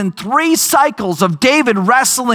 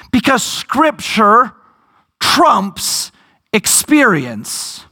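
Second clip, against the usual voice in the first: slow, deliberate "Because scripture trumps experience"; emphatic punchline; slower than usual. Real, not acted. Preacher lands his main point to the congregation, slow and firm. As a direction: space the words out and land "trumps".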